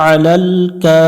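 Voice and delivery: আলাল কা sung with a pressed throat; throat-pressed ain. Shown as a demonstration. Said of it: "একটু গলা চাপবো". (আলাল গলা চেপে সুরে)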